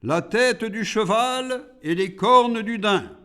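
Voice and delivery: high-pitched, insistent demon voice; demanding demon. A narrator's reading. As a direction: call out the demand as Iblis. Iblis demands animal parts.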